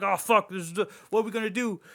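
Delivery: hammed-up alarm at a spreading fire; mock panic. Firefighters finding the house ablaze, mock panicked.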